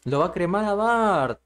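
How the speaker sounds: high, loud alarmed cry; alarmed outburst. Real, not acted. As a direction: blurt it out in shock. Shocked reaction to the cremation.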